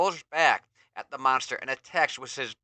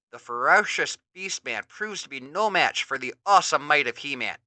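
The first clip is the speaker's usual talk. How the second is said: ordinary delivery, nothing marked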